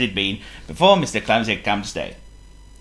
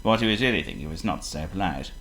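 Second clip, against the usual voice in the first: usual speaking voice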